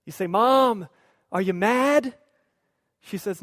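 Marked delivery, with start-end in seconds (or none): 0.2-2.2 put-on louder, questioning kid to mom; a hint of a worried kid; lightly voiced anxious child. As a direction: tentatively ask mom, voice lifting at the end. Child asks mom if mad.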